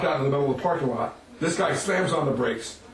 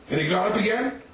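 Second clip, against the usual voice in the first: ordinary delivery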